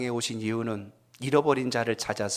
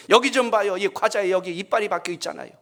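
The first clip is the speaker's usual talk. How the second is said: angry customer; 6 semitones higher than usual. Retold or imitated. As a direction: play an indignant diner showing the evidence. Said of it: "막 화를 내는 거예요". (화난 손님이 항의하는 흉내)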